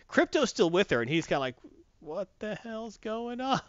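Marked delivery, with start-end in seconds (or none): 2.0-3.6 slow, soft, higher-pitched bewilderment; a baffled pet, drawn out quietly; puzzled dog voice. Acted. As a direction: give the confused dog a slow, hushed voice.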